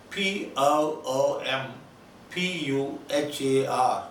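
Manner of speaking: slow letter-by-letter spelling. Shown as a demonstration. Teacher spelling the shop name slowly for students. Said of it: "We Telugu people sometimes call them Poompuhar".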